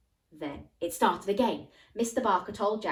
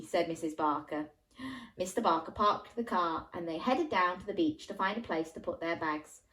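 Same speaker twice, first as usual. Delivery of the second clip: usual speaking voice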